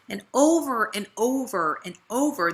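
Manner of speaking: ordinary delivery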